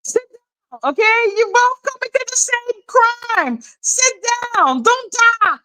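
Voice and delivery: high-pitched scold; high exasperated scolding. Real, not acted. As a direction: talk down at them, pitch way up.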